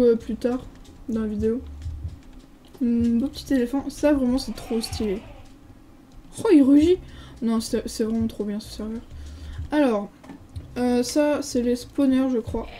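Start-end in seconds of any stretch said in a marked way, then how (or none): 6.4-7.0 loud, higher burst of real excitement; excited exclamation. Real, not acted. Gamer reacting to an elephant that roars, excited. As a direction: exclaim it loudly in delighted surprise.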